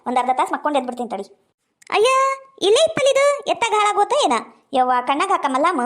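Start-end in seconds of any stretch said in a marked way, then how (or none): 2.0-4.4 much higher, puzzled cry; where did it go, baffled; puzzled searching. Acted. Mother searching for eye drops. (ಹುಡುಕುತ್ತ ಗೊಂದಲದ ಎತ್ತರದ ಧ್ವನಿ)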